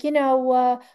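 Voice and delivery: ordinary talk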